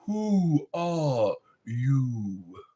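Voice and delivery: slow, drawn-out "Who are you?" like the caterpillar. Retold or imitated. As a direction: stretch it out, lazy and slow. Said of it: "You sound like the caterpillar".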